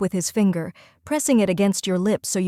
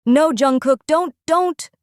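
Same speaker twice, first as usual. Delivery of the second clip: higher, rising desperate cry of no; frightened protest; 7 semitones higher, 4 dB louder than usual. Acted. Captive crying out at [name] to stop, frightened.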